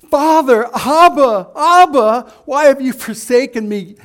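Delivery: loud, high anguished cry of "Father, Abba"; pleading cry to Father. Pretend. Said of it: "a very impersonal way of crying out to God".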